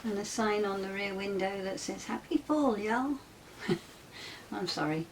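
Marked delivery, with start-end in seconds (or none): 2.0-3.2 hammed-up Southern American accent, pitched higher; a cheery, overdone American drawl; bad American accent. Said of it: "really bad attempt at an American accent".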